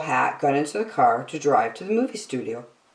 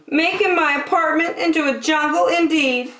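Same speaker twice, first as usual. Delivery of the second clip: high, huffy woman voice; woman's voice; 12 semitones higher than usual. A narrator's reading. Woman scolding about her ruined apartment. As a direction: sniff it out indignantly. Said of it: "said the woman".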